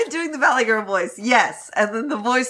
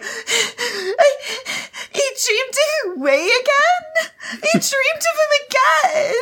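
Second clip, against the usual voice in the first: put-on high, whiny Valley Girl wail. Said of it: "And Wan Ling Chao's like".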